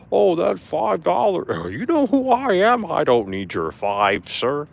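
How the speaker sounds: loud, high, drawn-out indignant imitation; mock pissed-off performer. Imitating the tipped performer refusing the five dollars, mock outrage. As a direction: mimic his offended self-importance, stretching words out. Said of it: "He got fucking pissed".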